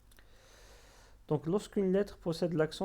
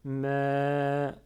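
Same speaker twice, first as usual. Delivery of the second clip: MA drawn out long, "mèèè"; prolonged vowel; 5 dB louder than usual. Shown as a demonstration. Learners hear ma with alif, MA held long. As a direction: hold the vowel of MA steady and long. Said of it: "suivi de alif sans accent".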